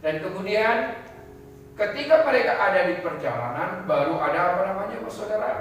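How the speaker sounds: high, loud, impassioned sermon voice; preacher building to a passionate peak; fiery preaching. Acted. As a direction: drive the point home to the congregation. Preaching builds to full force.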